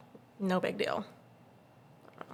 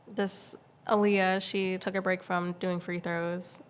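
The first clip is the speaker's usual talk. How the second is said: normal talking voice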